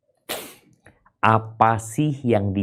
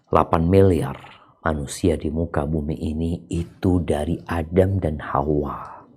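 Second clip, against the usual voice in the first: normal talking voice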